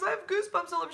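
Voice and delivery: high-pitched, moved retelling of her; welling-up supervisor. Retold or imitated. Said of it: "I actually had her in tears".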